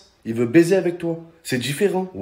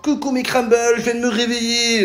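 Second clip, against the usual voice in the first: high, sugary mock vlogger voice; mock influencer; 7 semitones higher than usual. Comic imitating an influencer's wake-up video, cutesy and high. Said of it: "elle te raconte quoi?".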